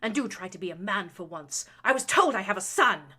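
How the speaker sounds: loud, rising contempt; scornful jab. A narrator's reading. Baroness belittling her son as he leaves. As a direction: sneer the last line.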